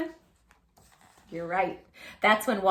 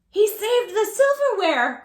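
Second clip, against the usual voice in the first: high, loud, delighted exclamation; overjoyed cry; 11 semitones higher, 9 dB louder than usual. A narrator's reading. Mother crying out in delight at the saved silverware. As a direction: burst out in joy. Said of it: "cried Mother".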